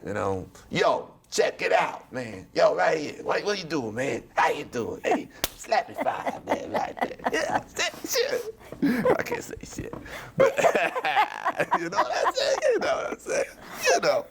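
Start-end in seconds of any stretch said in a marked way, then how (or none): 0.7-8.5 higher, slow, hammed-up rapper guy; rapper type. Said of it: "rapper type of guy". 9.2-14.2 much higher, laughing through the words. Real, not acted. Laughing after saying shit.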